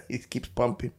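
ordinary talk

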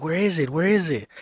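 a hint of a little girl; imitating sleep-talking daughter. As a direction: mimic a child searching in her sleep. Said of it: "looking under the pillow. She's, like".

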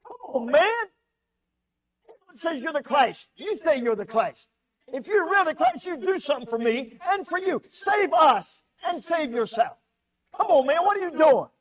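loud, high mocking rail at Jesus; railing criminal. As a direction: jeer at him like the bitter criminal on the cross. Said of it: "He railed at him".

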